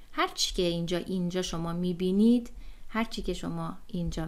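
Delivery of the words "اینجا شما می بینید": ordinary talk, nothing marked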